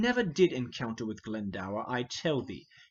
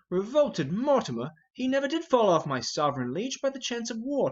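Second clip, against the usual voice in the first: louder and higher, flaring at the insult; indignant outburst; 7 semitones higher, 3 dB louder than usual. Acted. Hotspur hotly defending Mortimer against the king, indignant shout. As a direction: throw the king's word back at him.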